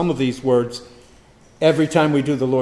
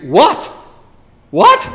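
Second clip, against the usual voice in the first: sharp, much higher, louder What; emphatic outcry; 11 semitones higher, 8 dB louder than usual. Acted. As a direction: cry out What in disbelief. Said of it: "very emphatically".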